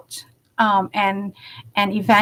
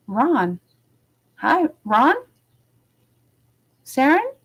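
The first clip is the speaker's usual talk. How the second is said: puzzled mock query; 5 semitones higher, slower than usual. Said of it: "He's like".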